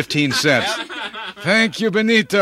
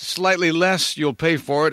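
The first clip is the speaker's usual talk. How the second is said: usual speaking voice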